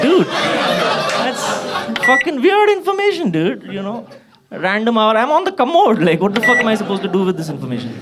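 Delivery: high-pitched, incredulous comic outburst; exasperated protest. Acted. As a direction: protest in disbelief at the overshare. Acted protest at dad's oversharing.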